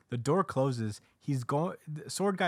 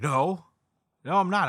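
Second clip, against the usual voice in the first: higher, louder flat protest; insistent denial; 8 semitones higher, 9 dB louder than usual. Retold or imitated. Sword Guy denying he's being used, insistent. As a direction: shut the question down hard.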